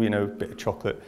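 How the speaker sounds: usual speaking voice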